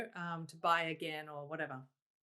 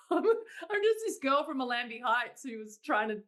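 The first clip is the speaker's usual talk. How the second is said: laughing disbelief; 5 semitones higher, 5 dB louder than usual. Retold or imitated. Laughing disbelief at Carnegie Hall.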